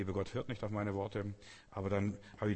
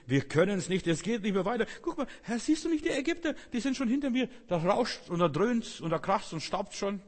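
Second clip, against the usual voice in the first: higher and louder, panicked whining; panicky complaining Israelites; 9 semitones higher, 5 dB louder than usual. Acted. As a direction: cry out in mock panic at the pursuing army. Israeliten klagen vor den Ägyptern. (jammernd, höher und lauter)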